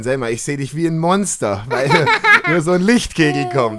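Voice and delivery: giggling through the words; laughing. Real, not acted. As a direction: laugh while you say it. Laughs at flashlight face.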